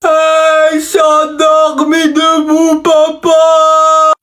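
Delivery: slow, far-off call; calling dad. Acted. Son calling out to wake his dozing father. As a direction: drag the words out to wake him. Child yells at sleeping father.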